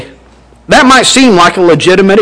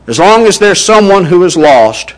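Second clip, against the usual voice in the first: ordinary delivery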